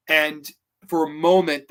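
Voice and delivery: usual speaking voice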